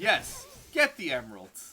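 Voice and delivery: villain voice. Retold or imitated. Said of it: "Eggman's just like".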